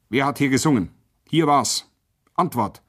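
short cutting bursts, demanding an answer; cutting interrogation. A narrator's reading. Lieutenant interrogates the silent prisoners, cutting. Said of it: "fragte er schneidend".

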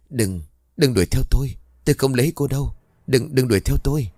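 high, frightened begging; whimpering plea. A narrator's reading. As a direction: beg in a trembling whine. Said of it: "miệng không ngừng rên rỉ". (rên rỉ van xin sợ hãi)

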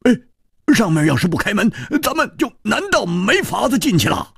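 high-pitched, excitable adventurer; animated high character voice; excitable Hu Tiehua. Acted. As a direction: voice him high and agitated, pushing the question.

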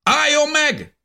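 high, loud soldier's shout; shouted halt; shouted command. A narrator's reading. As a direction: bark the order like a German soldier. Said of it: "kiáltotta újra az egyik német".

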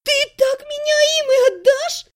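high, tearful protest; crying out. A narrator's reading. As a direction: wail in disbelief at your brother. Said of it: "продолжала кричать Элиса". (отчаянно, тонким плачущим голосом)

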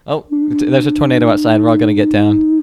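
loud, hammed-up tornado panic; mock panic. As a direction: yell everyone out like a fake emergency. Pretend tornado evacuation panic.

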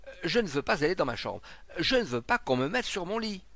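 high, loud, panicky protest; frightened refusal. A narrator's reading. Sick man refusing his room and bed, frightened protest. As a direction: plead loudly against being moved.

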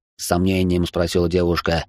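ordinary delivery, nothing marked

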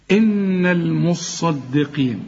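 "إن" held with ghunna, slow; nasalized inn. Shown as a demonstration. Tajweed teacher demonstrating "إن" with nasal hold. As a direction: hold the nun of "إن" nasal and long. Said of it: "هنا بقى عايزة فن قوي في النطق".

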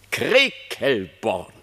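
Krekelborn stretched slowly syllable by syllable; drawn-out name. Acted. Reineke naming the hiding place to the king, slow. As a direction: spell Krekelborn out slowly for him.

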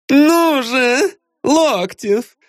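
high, insistent woman's voice; demanding diva. A narrator's reading. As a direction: demand it like a spoiled star. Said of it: "наконец потребовала она".

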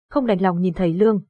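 usual speaking voice

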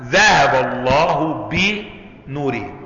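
very slow, drawn-out verse; slow Quranic recitation. Shown as a demonstration. Teacher reciting the verse for the class, very slow. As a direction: recite it word by word with reverence.

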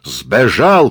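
loud one-word report; shout. Acted. Abdulla reports to Murat, shouting. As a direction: call it out across the crowd. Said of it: "кричит Мурату Абдулла".

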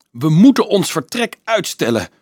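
high, loud and startled; alarmed cry. A narrator's reading. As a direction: call out in alarm. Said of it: "riep Nicolaas verschrikt".

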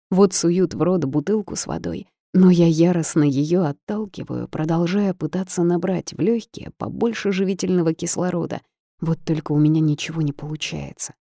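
plain reading voice

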